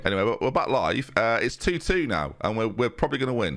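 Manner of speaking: usual speaking voice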